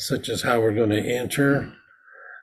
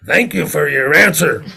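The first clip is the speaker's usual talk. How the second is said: loud, ringing thank-you; forceful thanks; 4 semitones higher, 5 dB louder than usual. Shown as a demonstration. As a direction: deliver the thanks with full force. Ceremony exchange builds to forceful.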